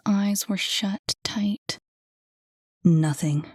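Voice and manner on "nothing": usual speaking voice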